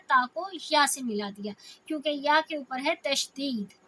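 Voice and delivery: normal talking voice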